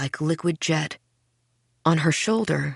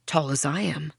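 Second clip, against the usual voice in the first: ordinary delivery, nothing marked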